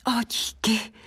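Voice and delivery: high, lightly hushed thanks; bashful thanks. A narrator's reading. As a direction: accept the compliment shyly. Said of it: "zarděla se pivoňka ještě temněji". (ostýchavé poděkování)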